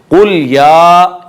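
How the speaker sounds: या held long in कुल या; madd. Shown as a demonstration. Teacher demonstrating the elongation, madd. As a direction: recite कुल या slowly, stretching the aa. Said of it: "दो अलिफ के बराबर मद की जाएगी".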